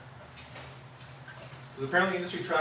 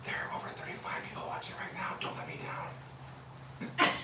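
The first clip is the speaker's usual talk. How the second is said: whisper; 11 dB quieter than usual. Real, not acted. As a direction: whisper it to the dog. Whispering to dog on camera.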